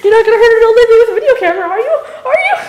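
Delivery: put-on loud, fast, high old lady act; mock old lady. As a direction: squeal like a frail old lady. Plays old lady with camera.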